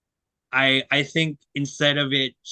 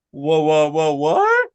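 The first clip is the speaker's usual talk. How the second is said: a louder, mock-stunned double take; shocked whoa; 2 semitones higher, 6 dB louder, slower than usual. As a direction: imitate parents gasping in disbelief, louder. Parents shocked seeing big teacher.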